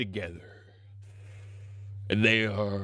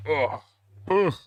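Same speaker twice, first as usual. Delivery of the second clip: ordinary talk, nothing marked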